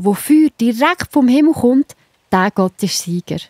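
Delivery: ordinary delivery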